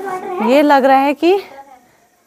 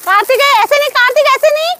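high, raised warning call; 12 semitones higher than usual. Real, not acted. Warning Kartik how to hold the firecracker, raised call.

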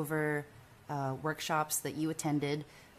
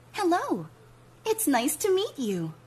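pitched way up, bright hello; much higher, friendly welcome; high cheerful greeting; 12 semitones higher, 5 dB louder than usual. Shown as a demonstration. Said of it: "repeated demo takes".

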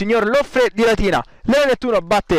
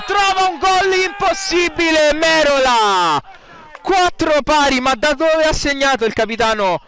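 breathless italian goal commentary; 4 semitones higher than usual. Real, not acted. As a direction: marvel at the impossible goal. Equaliser goal called.

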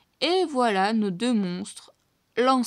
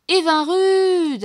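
very loud, long, high call; 8 semitones higher, 11 dB louder, much slower than usual. A narrator's reading. The two mice call Evinrude across the swamp. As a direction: call the dragonfly from afar. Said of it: "appellent les deux souris".

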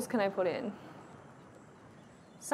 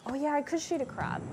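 ordinary talk

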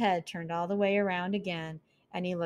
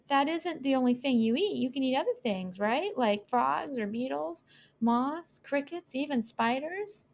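higher, quick nervous pleading; nervous mouse; 7 semitones higher than usual. Acted. Frightened mouse listing other food to the owl, higher and quick. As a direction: talk fast to steer him off you.

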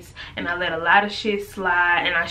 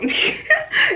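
breathy laugh. Real, not acted. Laughs after nap story.

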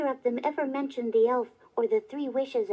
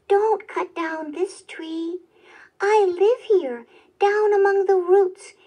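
polite elf plea; 5 semitones higher, 9 dB louder than usual. A narrator's reading. Elf begs the woodcutter to spare his home, politely insistent. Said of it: "said the elf very politely".

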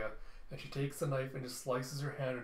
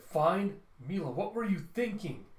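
higher, louder exasperated father; incredulous scolding; 5 semitones higher, 5 dB louder than usual. Acted. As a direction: throw the word back at her, pitched up. Father scolds his daughter.

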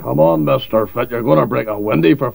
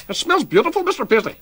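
high put-on voice; 11 semitones higher than usual. Guest flatters host in put-on voice.

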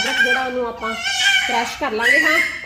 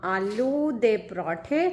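drawn-out announcement; 3 semitones lower, 4 dB quieter, much slower than usual. Real, not acted. Announcing dish to viewers.

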